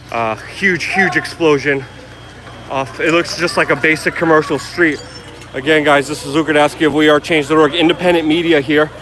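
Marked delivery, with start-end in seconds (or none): 3.0-8.9 louder, urgent on-the-scene news report; live field report. Real, not acted. Reporter identifies himself to viewers at a blast, loud urgent report. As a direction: announce yourself to camera over the noise.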